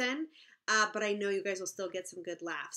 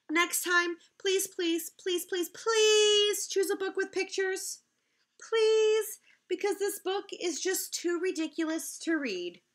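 mock begging; 9 semitones higher than usual. Reader begs for picture books.

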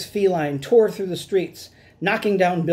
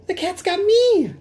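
high, louder squeaky cry; terrified mouse; 4 dB louder than usual. Acted. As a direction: cry out as the cat grabs you. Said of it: "shrieked Rosie".

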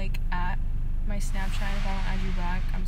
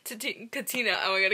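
ordinary delivery

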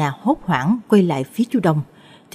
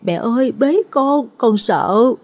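put-on high, frightened little boy; high-pitched tearful plea, acted; crying child calling mother; 6 semitones higher, slower than usual. A narrator's reading. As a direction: whimper like a scared small child. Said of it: "Mít khóc lóc gọi mẹ".

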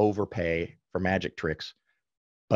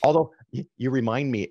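ordinary talk

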